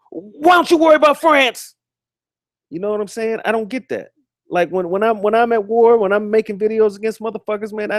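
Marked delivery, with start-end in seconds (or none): 0.1-1.7 high put-on mocking voice, much higher and louder; mocking the enemy. Streamer mocking a general who asks the enemy, high sneering voice. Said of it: "why the fuck are you asking the enemy".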